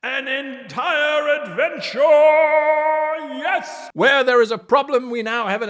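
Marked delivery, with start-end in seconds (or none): 0.0-3.9 higher, slow, shouted; excited exclamation. Shown as a demonstration. Teacher hyping the adventure idea to GMs. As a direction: cry it out like a triumphant announcement. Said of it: "what's happening with all the accents today".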